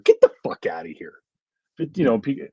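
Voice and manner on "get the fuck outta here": high, airy, half-whispered get outta here; breathy disbelieving brush-off; incredulous dismissal. Real, not acted. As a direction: scoff at a ridiculous answer. Dismissing a bad movie pick.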